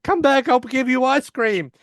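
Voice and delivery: high, loud mock wail; calling after someone. Sheldon calls after Penny running out, pleading loudly. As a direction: call out desperately in a squeaky imitation.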